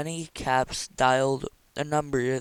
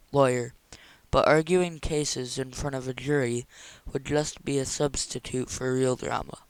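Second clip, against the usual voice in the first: plain reading voice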